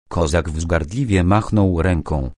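ordinary delivery

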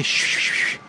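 whispered shh to calm the cat; hushing. Pretend. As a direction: hush it gently, all breath. Soothing the pretend cat.

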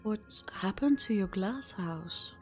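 ordinary delivery

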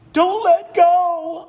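high, slow, loud pleading cub; little otter pleading with Mom; begging otter. Acted. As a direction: beg Mom not to let go. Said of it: "Otto begged".

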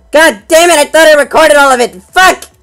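loud, high frustrated outburst; angry. Real, not acted. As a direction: vent loudly at your own mistake. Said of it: "I am so very angry".

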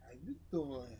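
low, soft "How you doing?" with a pause; low smooth pickup. Shown as a demonstration. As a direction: lean in and murmur it. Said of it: "It's called pause".

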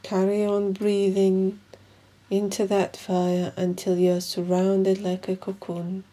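slow, measured meditation guide with long pauses; guided meditation. Acted. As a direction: guide them calmly through each breath, leaving room between phrases.

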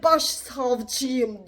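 low, slow, dainty mock of his self-introduction; mocking gentle prosecutor. Mocking the chief prosecutor's dainty self-introduction, low and slow. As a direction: drawl out the title like a prissy official. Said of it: "Böyle nazik bir şekilde".

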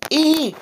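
very high mouse squeak; mock frightened squeak. A narrator's reading. Toby copying Daddy's frightened mouse, high squeak.

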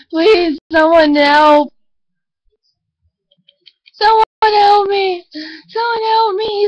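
hammed-up call for help; overacted plea; mock cry for help. Mock attack by dog.

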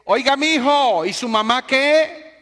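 put-on high, loud call of a deaf old man; old man shouting. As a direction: shout across the whole bus. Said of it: "el viejito se voltea". (el viejito grita a todo el bus)

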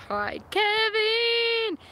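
cheery greeting. Acted. Reading a fan's greeting aloud.

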